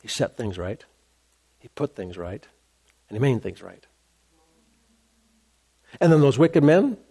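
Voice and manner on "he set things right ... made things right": soft emphasis. Real, not acted. Preacher drops to soft emphasis.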